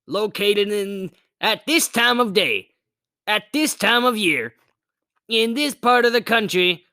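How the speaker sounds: lightly voiced nervous cover-up; Seymour voice. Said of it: "Delightfully devilish Seymour".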